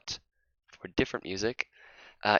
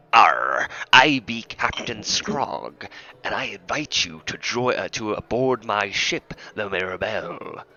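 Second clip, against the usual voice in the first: slow growly pirate captain. Acted. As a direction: play a hammy sea captain. GM voices pirate.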